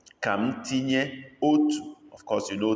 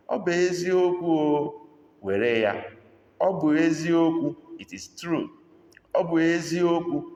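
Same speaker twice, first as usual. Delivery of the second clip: Ọ bụ eziokwu said high and loud, stressing eziokwu; emphatic demo; 8 semitones higher, 3 dB louder than usual. Shown as a demonstration. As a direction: hit eziokwu hard and high. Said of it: "How do we say that?".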